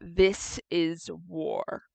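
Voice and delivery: low, slow, quiet menace; grim declaration. Acted. Shar as the doors slam closed, low grim declaration. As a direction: declare it slowly under your breath.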